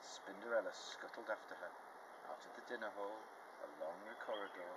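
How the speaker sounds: usual speaking voice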